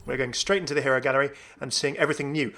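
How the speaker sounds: usual speaking voice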